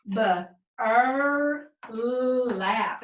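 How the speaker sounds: "B, er, lap" in separate chunks, last sounds joined; slow phonics blending of "B, er, lap". Shown as a demonstration. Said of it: "Read it again".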